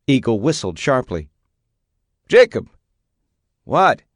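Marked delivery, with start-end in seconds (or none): 2.3-2.7 high, loud, sharp shout of a name. A narrator's reading. Father shouting at the son who ignored him, sharp loud call. 3.6-4.0 higher, louder annoyed reply; shouted back. A narrator's reading. Son shouting back at his father, annoyed and loud.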